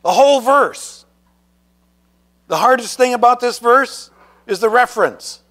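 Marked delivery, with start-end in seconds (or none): 0.0-1.0 higher and louder, proudly announcing it; proud recitation. Shown as a demonstration. Preacher modeling a proud answer for the children. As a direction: show the kids how to boast about knowing it. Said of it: "you say".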